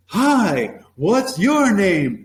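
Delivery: high, drawn-out, punchy greeting; playful ambush greeting. Acted. Class ambushing a latecomer, mock-ambush greeting. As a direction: pounce on them with a big slow hello. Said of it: "gonna get ambushed".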